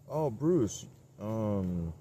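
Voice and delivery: high, loud mock crew guy voice; mock film crew guy. Crew guy calls Bruce to set, mock high and loud. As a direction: call out to Bruce like a nagging assistant. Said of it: "guy was probably like".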